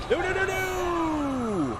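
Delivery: drawn-out desperate no; panicked shout. Real, not acted. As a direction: cry out as you lose. Chased, dying in game.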